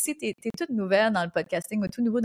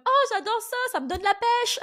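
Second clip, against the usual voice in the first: much higher, gushing praise; imitated delighted clients; 12 semitones higher than usual. Podcaster quoting European clients who love her accent, gushing delight. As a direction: gush like a thrilled fan.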